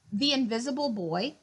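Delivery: ordinary delivery, nothing marked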